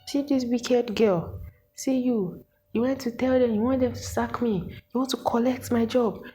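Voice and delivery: high, accusing voice, word after word; ranting lecturer. Retold or imitated. Lecturer accusing the student of reporting her, high and scolding. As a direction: rant at her like a bitter woman in charge.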